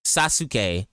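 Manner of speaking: Sasuke in three full syllables, sah-SOO-kay; Sasuke said slowly as sah-soo-kay; mispronounced Sasuke. Shown as a demonstration. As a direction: sound out the u in Sasuke. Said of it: "You don't say".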